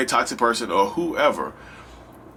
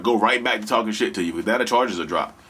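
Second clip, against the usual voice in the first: ordinary talk, nothing marked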